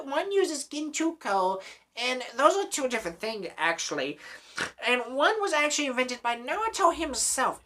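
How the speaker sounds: mocking nerd voice. Mocking the Naruto fans with a high, know-it-all nerd voice. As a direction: play the pedantic anime nerd correcting everyone. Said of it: "it's the most nerdy shit ever".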